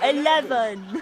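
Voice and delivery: slow, loud mock-English eleven through laughter; laughing posh eleven; laughing English accent. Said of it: "Try an English accent".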